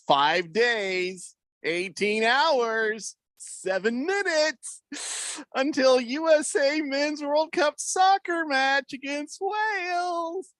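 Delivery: slow rising chant of a countdown, topping out very high; drawn-out hype countdown. Acted. Reads fan countdown in drawn-out build.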